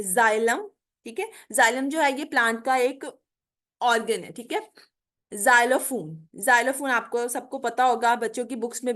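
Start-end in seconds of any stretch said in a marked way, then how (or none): 0.0-0.7 जाइलम with a z sound at the start. Shown as a demonstration. Teacher showing x as z: जाइलम. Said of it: "इसको हम जी की, अ, sound में pronounce करेंगे". 5.3-6.3 जाइलोफोन begins with a clear z. Shown as a demonstration. Teacher demonstrating initial x as z: जाइलोफोन. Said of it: "इसको हम जी की, अ, sound में pronounce करेंगे".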